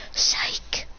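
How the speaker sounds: soft voiceless tease; hushed "just kidding"; whispered psych. Acted. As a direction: whisper the joke retraction. Joking retraction.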